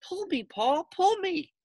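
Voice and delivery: tiny high tempting voice; the pigtail tempting Paul, high and sly; little pigtail voice. Acted. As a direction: coax him like a little voice. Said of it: "a little voice coming from the pigtail".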